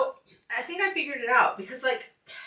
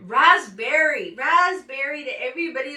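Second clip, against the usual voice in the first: playful jeer; 4 semitones higher, 9 dB louder than usual. Real, not acted. Host to impatient viewers, loud playful jeer. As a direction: throw it out as a teasing rebuke.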